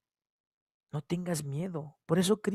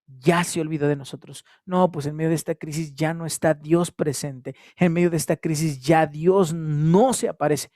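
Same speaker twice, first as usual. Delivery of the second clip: despairing complaint; 8 dB louder than usual. Retold or imitated. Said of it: "la impotencia no nos lleve a decir".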